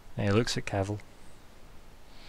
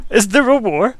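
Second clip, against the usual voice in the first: hammed-up mock outrage, higher and louder; faux shock; 10 semitones higher, 10 dB louder than usual. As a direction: feign offended surprise, voice up and louder. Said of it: "that faux shock".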